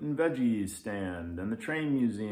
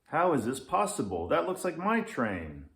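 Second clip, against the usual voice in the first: higher, louder amazed lynx; astonished; 5 semitones higher, 4 dB louder than usual. Acted. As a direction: gasp it out in disbelief.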